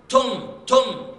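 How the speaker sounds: ordinary talk, nothing marked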